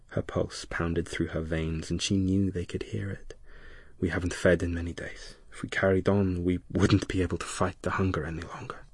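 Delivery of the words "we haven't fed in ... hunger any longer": fast hissing whisper; sibilant hiss. Acted. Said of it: "a sibilant hiss".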